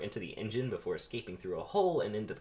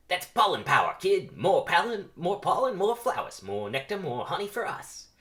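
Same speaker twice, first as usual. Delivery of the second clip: high, loud rallying chant; rousing pep talk; 8 semitones higher, 8 dB louder than usual. Acted. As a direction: fire up the rookie. Veteran bee pep talk.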